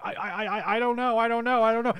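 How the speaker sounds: put-on high, stammering terrified child; mock crying kid. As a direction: stammer it like a panicking little boy. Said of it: "just starts crying immediately".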